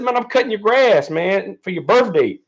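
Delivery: mildly imitating a cheerful neighbor; lightly voiced old neighbor. Old neighbor explaining why he mowed the yard, lightly imitated. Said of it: "He said".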